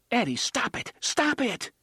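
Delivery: urgent plea. Acted. Susanna begs Eddie to stop.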